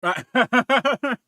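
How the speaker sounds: high, loud cackle; loud burst of laughter. Real, not acted. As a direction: crack up at the chewing noise. Chewing into the mic.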